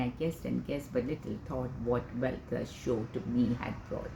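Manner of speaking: measured, mostly low and soft poetic reading; poem recital. Acted. Recites Wordsworth's Daffodils poem.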